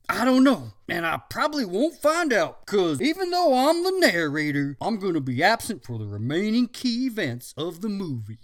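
jolly dad voice. Acted. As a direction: announce a gift heartily. Father voiced.

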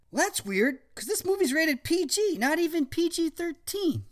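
put-on teen boy voice. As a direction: play a smug cool teen. Zach voiced.

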